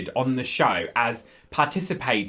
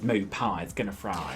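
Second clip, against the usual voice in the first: softer, low-pitched catchphrase imitation; quoted sitcom character; 6 semitones lower, 7 dB quieter than usual. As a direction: lightly imitate the line, low and soft. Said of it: "she just says".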